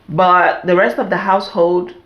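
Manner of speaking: normal talking voice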